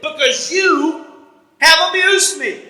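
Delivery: slow wounded complaint, drawn out; aggrieved Balaam, slow. Retold or imitated. Balaam complaining slowly to his donkey, aggrieved. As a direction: lean on every word like a hurt man.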